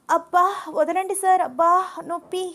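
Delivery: high, loud yelps of pain; crying out in pain. Acted. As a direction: squeal as your ear is twisted. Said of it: "గింజుకుంటోంది". (చెవి మెలిపెడితే నొప్పితో అరుపు)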